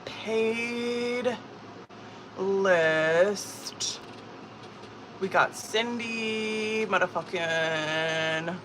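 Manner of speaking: slow, soft, stretched-out words; drawn-out list writing. Real, not acted. Streamer rewriting the paid list aloud, slowly drawn out. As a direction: stretch each word as you write it down.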